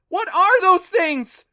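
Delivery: high, loud terrified shriek; drawn-out screamed question. A narrator's reading. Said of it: "Clancy shrieked".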